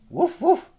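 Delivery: high little dog woofs; barking dog. A narrator's reading. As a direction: woof like a small dog in a storybook. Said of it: "barked the dog".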